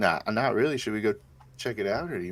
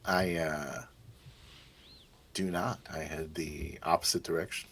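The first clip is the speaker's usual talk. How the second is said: usual speaking voice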